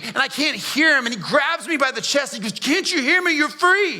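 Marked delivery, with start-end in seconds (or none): 2.5-4.0 higher and pressing, as if shaking him; urgent call. Retold or imitated. Jesus grabbing the dazed prisoner, urging him, raised and high. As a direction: insist he listen, voice raised.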